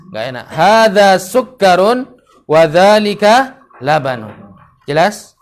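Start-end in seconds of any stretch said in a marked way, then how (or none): 0.5-4.3 sukkarun with a long held kk; correct doubled k. Shown as a demonstration. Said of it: "Jangan sukarun".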